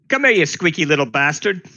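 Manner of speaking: angry shout. Acted. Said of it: "she'll be saying".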